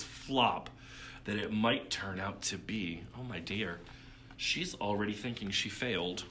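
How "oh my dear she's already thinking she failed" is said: ordinary delivery, nothing marked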